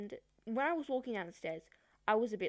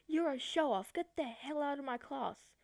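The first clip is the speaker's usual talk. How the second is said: higher, sharper imitation of a teacher yelling; mock angry teacher; 6 semitones higher than usual. Teacher throws crying student out of class, mock angry. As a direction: play the teacher ordering her out, high-pitched.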